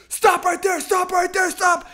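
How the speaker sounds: high, loud, urgent repeated command; shouted police order. Retold or imitated. Cops running up to the teens, shouting. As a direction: imitate cops yelling a halt.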